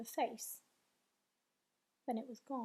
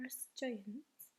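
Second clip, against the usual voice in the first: much softer, hushed; whispered; 2 semitones lower, 9 dB quieter than usual. A narrator's reading. BFG confiding in Sophie, hushed whisper. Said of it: "the B F G whispered".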